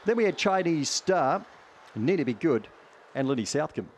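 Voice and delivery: fast live race commentary; race caller. Acted. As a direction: rattle off the field like a track caller. Calls the dog race live.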